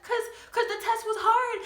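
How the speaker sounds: high, defensive little voice; pleading kid. Retold or imitated. Kid defending herself to dad, high and pleading. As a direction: whine the excuse. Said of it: "And I'd be like".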